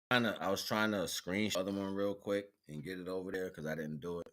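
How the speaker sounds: ordinary talk, nothing marked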